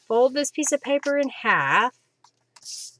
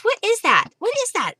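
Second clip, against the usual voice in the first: high, squeaky dog's voice; voicing the dog; 11 semitones higher, faster than usual. Crafter voices her puppy asking about the glue, high. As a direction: give the pup a curious little voice. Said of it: "He says".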